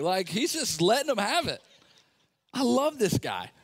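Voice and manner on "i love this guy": ordinary talk, nothing marked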